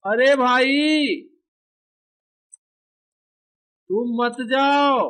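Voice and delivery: very slow, high acted plea; calling after someone. Character asking brother to stay, drawn-out calling.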